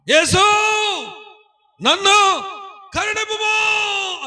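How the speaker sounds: high, loud pleading shout, last word drawn out slowly; desperate cry. Retold or imitated. Blind beggar begs Jesus for mercy, shouting and drawn out. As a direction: beg for mercy at the top of your voice. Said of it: "అని అరుస్తున్నాడు".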